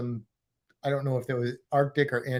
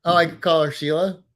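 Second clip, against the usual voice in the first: usual speaking voice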